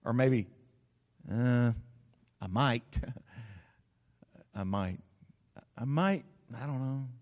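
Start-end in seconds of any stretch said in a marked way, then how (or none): 1.3-1.7 low, reluctant stall; unemphatic hesitation. Shown as a demonstration. Preacher showing weak commitment, low hesitant stall. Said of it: "haven't been quite that emphatic". 2.4-7.1 low, soft, hesitant "might"; unemphatic, noncommittal. Shown as a demonstration. Preacher showing weak commitment, soft wavering "might". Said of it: "haven't been quite that emphatic".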